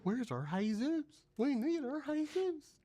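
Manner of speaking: slow, high searching call; little old lady. Retold or imitated. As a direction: imitate an old lady calling for someone missing. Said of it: "this dude and the old, little old lady".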